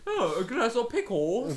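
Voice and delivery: high, slow, loud fake British accent; mock British accent. Mocking friend's British accent, hammed-up British accent. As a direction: ham up a bad British accent. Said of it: "You can't even do it".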